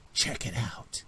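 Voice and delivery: hushed, voiceless whisper; whispered aside. Acted. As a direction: whisper it to an accomplice. Raider aside to his friend.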